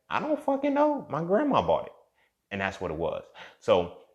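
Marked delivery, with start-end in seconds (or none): 0.1-1.9 very high-pitched indignant comeback; mock exasperation. Answering kids who question his shirt, exasperated. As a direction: throw it back high and defensive. Snapping back about unbranded shirt.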